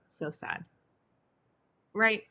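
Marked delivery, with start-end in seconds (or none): 0.1-0.7 whispered fake sadness; mock sad whisper. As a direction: sigh it out in a pitying whisper. Carbon sad about negative charge.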